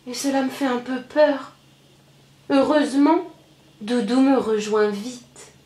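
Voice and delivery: plain reading voice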